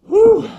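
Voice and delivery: high, loud whistled-out "whew"; short high whoop of relief; relieved whew. Pretend. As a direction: let out a big, showy whew. Recovering after the pretend crash.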